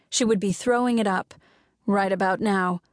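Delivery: plain reading voice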